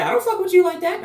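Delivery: high, fast and dismissive; the bad side brushing him off, high and quick; devil voice. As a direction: snap it off like a cocky little devil. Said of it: "He was like".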